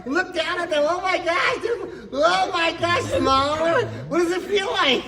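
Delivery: very high, loud, giddy wonder; amazed excitement. Acted. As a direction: shout as if looking down from a great height. Acting amazed at floating high up.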